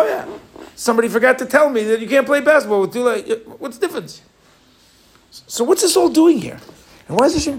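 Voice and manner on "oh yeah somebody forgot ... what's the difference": sarcastic retort. Acted. Mock retort to Hashem.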